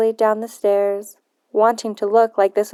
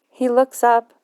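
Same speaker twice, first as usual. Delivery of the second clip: usual speaking voice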